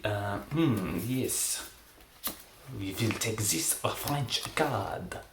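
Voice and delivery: attempted French accent, slow theatrical count. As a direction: play a hammy French magician, accent and all. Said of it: "I will be doing a French accent".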